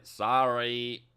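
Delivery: ordinary talk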